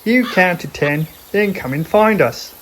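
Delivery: high, loud koala voice; a playful koala giving the rules; Koala's voice. Acted. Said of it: "laughed Koala".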